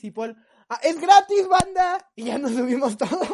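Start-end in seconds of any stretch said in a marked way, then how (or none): 0.7-2.1 much higher and louder, delighted discovery; excited realization. Retold or imitated. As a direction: blurt it out like you just won something. Paul realizes the bus is free. (sorpresa eufórica)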